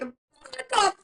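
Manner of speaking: high, loud mock outrage; inner outrage. Friend voicing mom's inner reaction to puke, high and loud. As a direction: shriek it in your head. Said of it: "mentally inside, you're like".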